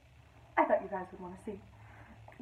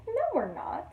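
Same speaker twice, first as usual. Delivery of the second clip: put-on high confident small-girl reply; a hint of a confident little sister; 12 semitones higher, 6 dB louder, slower than usual. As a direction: answer brightly as the little sister.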